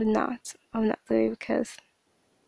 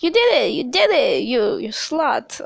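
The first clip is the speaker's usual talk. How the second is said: accusing; 3 semitones higher, 4 dB louder than usual. Retold or imitated. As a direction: throw the blame at her, mocking. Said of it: "And you were like".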